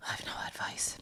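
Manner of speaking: a soft, breathy whisper off to the side; whispered aside. Real, not acted. Co-host whispering that she has no advice, sheepish. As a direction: whisper it like a guilty confession.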